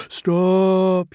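high, pleading diesel; begging. Acted. The dizzy diesel pleading with the twins. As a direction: beg him to stop. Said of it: "he begged".

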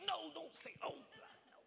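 high, very soft mock wail; retold anguished plea. Young sinner begging his pastor not to apologize, hushed anguished plea. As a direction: plead it in a small, high, hushed voice. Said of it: "And I was like".

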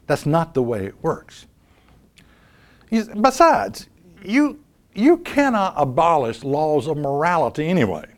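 ordinary talk, nothing marked

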